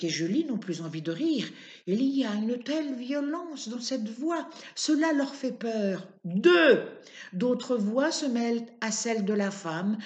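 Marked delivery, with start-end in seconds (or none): 6.2-6.9 yelled count, louder; old woman counting down to shoot the donkey; furious count. Acted. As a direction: bark the number as a threat. Counting down before the shot.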